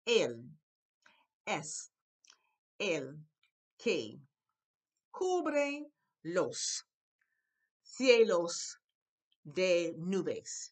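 loud, separated words with a pause after each; word-by-word reading. Shown as a demonstration. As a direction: model the Spanish one word at a time. Teacher reads Spanish word by word.

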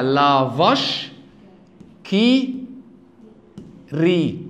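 "لا فاش كي ري" slowly, word by word with pauses; French pronunciation. Shown as a demonstration. Teacher reads the brand off the board, slow French pronunciation. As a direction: spell out the French name word by word, with a v in vache. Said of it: "اسمها كده".

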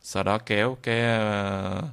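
ordinary talk, nothing marked

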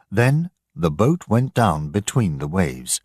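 usual speaking voice

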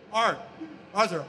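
normal talking voice

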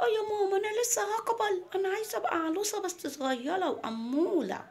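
put-on high, babyish child voice; little girl Mona. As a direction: play a small girl pleading her case to mum. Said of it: "قالت لها". (صوت طفلة رفيع ودلوع)

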